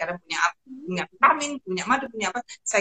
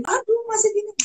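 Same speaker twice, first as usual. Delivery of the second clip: high-pitched mock fretting; imitated parental worry; 14 semitones higher than usual. Parents hearing her sick on the phone, imitated high worry. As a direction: fret like an anxious parent, pitched up.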